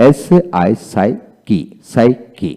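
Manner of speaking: এসএআই psyche read slowly, sound by sound; teacher slowly reads এসএআই psyche as a sound guide; slow phonetic reading. Shown as a demonstration. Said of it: "IPS symbolic sound হলো".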